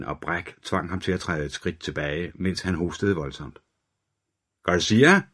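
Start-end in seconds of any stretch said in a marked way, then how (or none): 4.6-5.2 raised, rising call from the doorway. A narrator's reading. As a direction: call the name into the stinking room. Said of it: "råbte han igen fra døråbningen".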